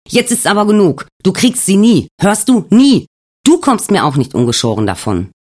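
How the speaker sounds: high, loud shout building to a scream on Nie; furious shout. Acted. The ghost screams at Alex that he never gets her.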